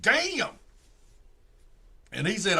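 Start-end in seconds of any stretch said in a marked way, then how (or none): 0.0-0.6 impressed damn. Real, not acted. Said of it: "I said".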